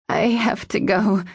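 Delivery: hoarse, strained croak. Acted. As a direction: force it out hoarsely. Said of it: "she croaked out".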